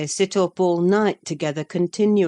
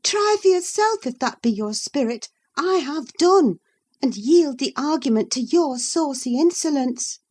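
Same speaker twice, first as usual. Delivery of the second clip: high, louder, done with her; contemptuous dismissal; 9 semitones higher, 5 dB louder than usual. Acted. Catherine quitting the quarrel with Isabella, scornful. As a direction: yield with scorn.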